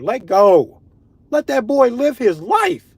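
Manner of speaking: high, slow, loud proclamation; emphatic voice of Nature; Nature commanding. Acted. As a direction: draw it out as a grand command. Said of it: "Nature told her".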